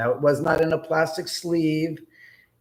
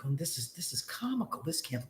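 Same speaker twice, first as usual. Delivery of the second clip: much softer amused aside; hushed aside; 14 dB quieter, faster than usual. Retold or imitated. Watching Lindsay count cash, a soft disbelieving aside. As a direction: murmur it to yourself in disbelief.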